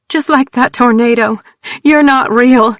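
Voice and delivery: high, loud frantic insistence. A narrator's reading. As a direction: insist almost hysterically.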